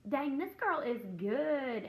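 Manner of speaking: drawn out, half-laughing respect; impressed. Retold or imitated. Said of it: "I was like".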